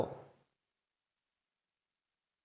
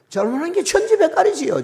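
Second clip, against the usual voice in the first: much higher and louder, mock-exasperated; exaggerated confession; 12 semitones higher, 6 dB louder than usual. Real, not acted. A preacher confessing his faults to the congregation, hammed up high and loud. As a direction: blurt it out high, hamming up the guilt.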